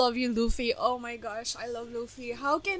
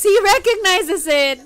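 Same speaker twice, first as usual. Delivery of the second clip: usual speaking voice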